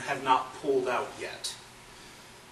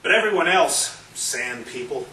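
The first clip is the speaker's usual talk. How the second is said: loud, emphatic spoken-word delivery; forceful poem recitation; 4 semitones higher, 6 dB louder than usual. Acted. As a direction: punch the key phrases loud and high. Performs own poem.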